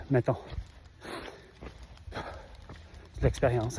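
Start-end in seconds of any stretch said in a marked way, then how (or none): 2.1-3.8 slow breathy whisper. Pretend. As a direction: drop to a hushed whisper. Same line said twice differently.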